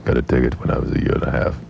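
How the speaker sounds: put-on low, droning German-accented statesman; Henry Kissinger. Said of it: "the story with the accent".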